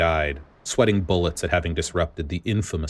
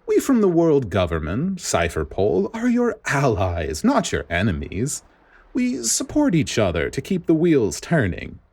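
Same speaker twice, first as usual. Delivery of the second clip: sugary scheming official; 6 semitones higher than usual. Acted. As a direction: purr an apology that is really a jab. Spandine flatters the vice admiral.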